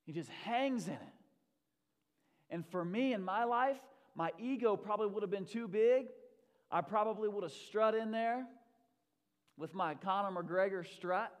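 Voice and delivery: loud, driving sermon delivery; impassioned preaching. Real, not acted. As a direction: preach it with rising force. Preaches at full force.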